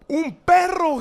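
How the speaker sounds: high, very loud warning cry; alarmed shout. Acted. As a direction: yell out the danger to your friends. Boy's alarm at charging dog.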